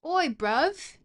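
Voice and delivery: loud, high mock British street call; exaggerated British geezer accent. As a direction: holler it like a hammed-up Brit. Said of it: "speak in a British accent".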